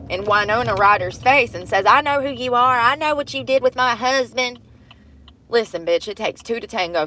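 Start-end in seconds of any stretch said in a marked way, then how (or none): 1.9-4.6 angry Karen. Retold or imitated. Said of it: "some angry Karen comes marching up".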